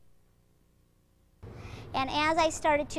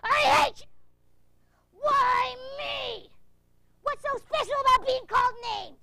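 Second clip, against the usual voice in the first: furious, high-pitched shouting; monster dance rage; 10 semitones higher, 6 dB louder than usual. Shown as a demonstration. Showing the monster dance, furious shout. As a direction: roar out pent-up anger, loud and high. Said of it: "I'll show you what it looks like".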